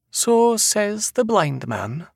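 high, drawn-out Scottish seer; knowing seer. A narrator's reading. Seer answering the man's vow, high and slow. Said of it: "Yolanda replied".

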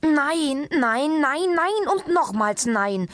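high, drawn-out frantic refusals; frantic cry. A narrator's reading. Patient refusing every sport his doctor suggests, frantic. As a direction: cry out each "nein", beside yourself, without full volume. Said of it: "schrie Herr Ombovesi außer sich".

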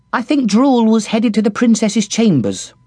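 light and eager, giving news; young kitchen girl Squeak. A narrator's reading. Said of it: "Squeak said".

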